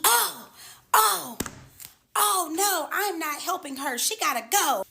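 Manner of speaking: high-pitched imitation of a protesting man; high mocking retelling of an objection; mock refusal.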